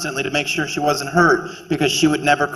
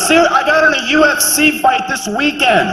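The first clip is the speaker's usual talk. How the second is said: higher, hammed-up boasting parent voice; proud fight parent; 9 semitones higher than usual. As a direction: brag about your daughter like a crazed sports parent.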